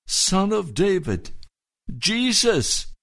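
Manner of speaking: high, pleading cry, slowing on "please show mercy". Retold or imitated. Blind man crying out to Jesus, a high pleading cry. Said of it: "began to cry out at the top of his lungs".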